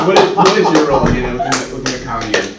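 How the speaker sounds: cracking up while asking; laughing. Real, not acted. Breaks up laughing mid-question.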